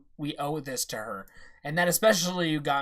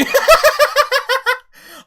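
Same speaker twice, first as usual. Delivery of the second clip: very high, loud burst of laughter; explosive cackling laugh; 15 dB louder than usual. Real, not acted. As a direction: crack up uncontrollably. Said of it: "I laughed like crazy".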